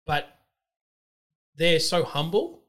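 ordinary talk

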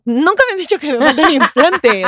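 high, loud retold confrontation; a retold, half-playful accusing cry; indignant outburst. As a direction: throw the accusation at him in disbelief. Confronting boyfriend about missing implant.

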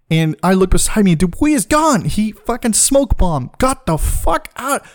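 normal talking voice

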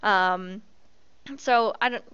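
ordinary talk, nothing marked

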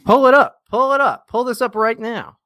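high, urgent repeated order; insistent demand. Real, not acted. Demanding to see the picture.

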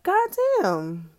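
high, loud incredulous exclamation; mock astonishment. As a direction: blurt it out in shocked disbelief. Reacting to pregnant patients.

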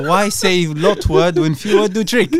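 slow, loud mock-primitive voice; caveman talk. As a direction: play a caveman, dragging out each word. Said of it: "talk to him like a caveman".

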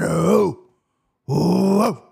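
eager drawn-out ohs; can't contain the hype; excited. Real, not acted. Host hyping the UFC card to fans, excited ohs. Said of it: "I'm so fucking excited".

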